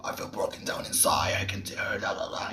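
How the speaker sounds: whispered, very soft dark voice; hushed, shadowy demonstration; dark shadowy silhouette. Shown as a demonstration. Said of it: "you're supposed to be".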